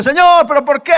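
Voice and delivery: high, loud reproachful cry; angry Martha. Acted. Preacher acts Martha confronting the Lord, angry outburst. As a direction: demand an answer, indignant. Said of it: "Y enfadada y le dice".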